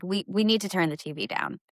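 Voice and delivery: ordinary talk, nothing marked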